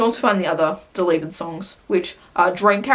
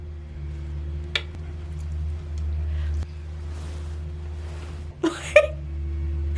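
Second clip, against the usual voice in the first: silent wheezing laugh; 9 dB quieter than usual. Real, not acted. Finds the fact funny.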